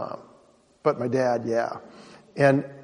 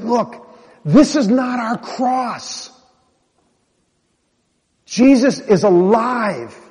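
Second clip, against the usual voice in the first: slow, fervent preacher's cadence, higher and louder; preaching; 6 semitones higher, 5 dB louder, slower than usual. Retold or imitated. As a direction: preach it with fire in her eyes. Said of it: "She was, like, preaching".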